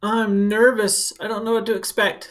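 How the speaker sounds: lightly voiced anxious student; mock nervous child. Child nervous about starting school, mildly worried. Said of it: "That's a little worry spot".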